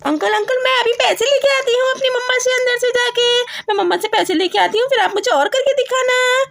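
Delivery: put-on high, eager child voice; high childish voice, excited; excited little girl. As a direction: play the little daughter asking mom. Child voice promises.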